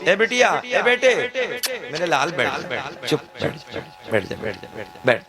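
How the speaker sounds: put-on soothing a child. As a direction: hush a fidgety kid gently. Elder soothing a restless child. (बच्चे को प्यार से चुप कराते हुए, धीमे से)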